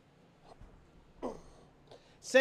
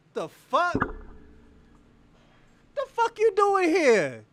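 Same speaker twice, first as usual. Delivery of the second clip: incredulous outburst; 7 semitones higher, slower than usual. Real, not acted. Streamer asking in disbelief why his opponent is there. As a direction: stretch it out in shocked disbelief.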